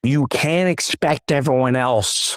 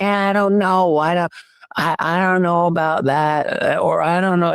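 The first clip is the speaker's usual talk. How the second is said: hesitant mock voice; 7 semitones higher than usual.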